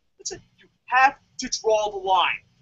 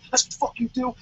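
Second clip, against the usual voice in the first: ordinary talk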